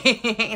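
quick amused laugh; a little burst of laughter; brief light laugh; short giggle. Real, not acted. Laughs after warning.

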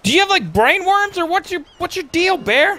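very high incredulous squeal; mock exasperation. Real, not acted. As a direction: question the bear in comic bewilderment. Confronts odd bear.

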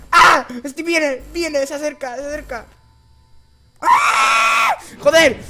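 high anxious voice building to a loud scream; tense fright. Real, not acted. Player watching the enemy approach, fear building to a scream. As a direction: panic rising as it comes closer.

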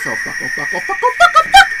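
rising panicked yelps breaking into a laugh; panicked cursing. Real, not acted. Streamer flees the ghost, panicking then laughing.

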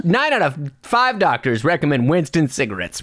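upbeat retro cigarette commercial; chipper 1950s ad. Pretend. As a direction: sell it like an old-time radio announcer. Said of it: "Brian, hold on".

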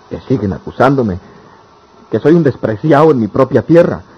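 rising to a pained shout; anguished outburst. Acted. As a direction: cry out against the injustice. Lover complains of persecution.

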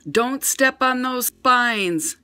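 high, slow warning call; urgent shout. A narrator's reading. Liz warning the baby about sea urchins, slow urgent call. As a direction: call it out slowly so the baby listens. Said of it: "shouted Liz".

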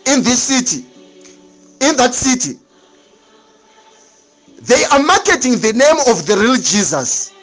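ordinary talk

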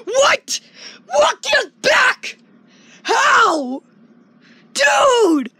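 high, astonished reenactment of hearing the news; retold disbelief. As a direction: blurt each word in amazed disbelief. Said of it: "And I was just like".

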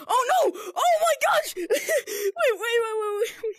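high, loud startled outburst; real frantic, laughing excitement; excited alarm. Real, not acted. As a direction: shout it in a sudden thrilled panic. Sudden discovery while playing.

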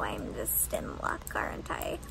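sarcastic little whisper. Real, not acted. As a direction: breathe it out as a wry aside. Sarcastic aside about being cheated.

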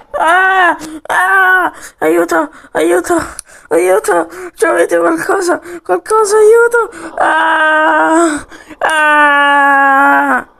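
high, loud screams of aiuto; panicked cries for help. Pretend. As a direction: yell for help as the plane hits. Soldier attacked by plane.

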